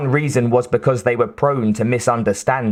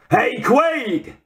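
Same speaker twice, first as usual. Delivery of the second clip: much higher Brooklyn call-out; 13 semitones higher than usual. A narrator's reading. Harry calling to Quaid in the rain, higher Brooklyn shout. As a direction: hail him across the rain in Brooklyn. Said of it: "That Brooklyn accent was unmistakable".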